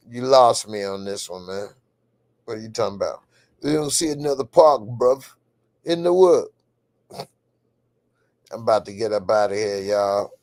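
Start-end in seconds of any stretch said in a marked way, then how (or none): 3.6-6.5 higher, punchy British bruv voice; mock British accent. Host answering a viewer's comment, mock British accent. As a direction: put on a London lad's British accent.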